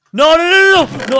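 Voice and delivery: high loud frantic denial; shouting in alarm at losing everything. Real, not acted. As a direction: panic as items vanish. Inventory about to clear.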